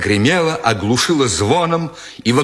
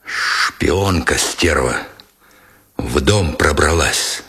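low, slow rasp of contempt; rasping sergeant; 7 semitones lower, slower than usual. A narrator's reading. Sergeant accusing the woman of spying, low slow rasp. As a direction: wheeze it out, lower and slow. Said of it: "просипел Меженин".

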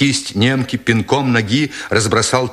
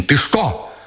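higher, appalled outcry; desperate cry; 4 semitones higher than usual. A narrator's reading. Nikitin confronts the sergeant, desperate raised pitch. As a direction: cry it out in disbelief, not at full volume. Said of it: "отчаянно крикнул Никитин".

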